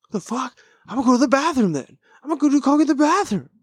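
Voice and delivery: very high-pitched, fast mock protest; squeaky put-on voice of defiance; indignant party guest. Acts out defiant reply to party ban.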